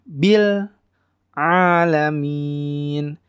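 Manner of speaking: alamin slow, opened with throaty ain; clear ain. Shown as a demonstration. Teacher models alamin with clear ain. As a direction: squeeze the first sound of alamin in the throat. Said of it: "Ainnya harus jelas".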